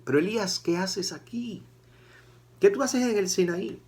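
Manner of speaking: high, pointed rebuke; God confronting the runaway prophet, high and insistent; God questioning Elías. Retold or imitated. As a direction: demand to know why he's here. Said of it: "Dios inmediatamente le dice a Elías".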